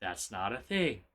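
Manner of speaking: soft, low, spaced-out teaching line; slow call-and-response lead. Shown as a demonstration. Streamer leading chat to repeat the correction, slow and quiet. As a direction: say it slowly for others to repeat. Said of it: "Say it with me now".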